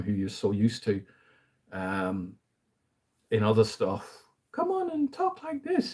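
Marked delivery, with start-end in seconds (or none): 4.5-5.9 very high squeaky cartoon impression; Mickey Mouse-ish voice. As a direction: mimic an actor's jarring falsetto character voice. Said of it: "He's very sort of Mickey Mouse-ish".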